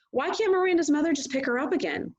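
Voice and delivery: mother arguing with dad; arguing mom. A narrator's reading. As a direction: argue the point sharply. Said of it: "Mom argued with him".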